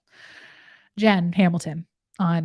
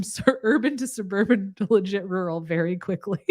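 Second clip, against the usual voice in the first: ordinary talk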